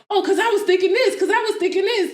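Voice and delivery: high, quick mimicked chatter; mock eager excuse. Co-host mimicking their own repeated excuses, high and hurried.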